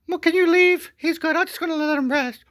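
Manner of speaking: very high, loud mock lady voice; falsetto woman. Wife asking security to unload the husband, in a high falsetto. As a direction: chirp it sweetly like nothing happened. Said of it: "she's like".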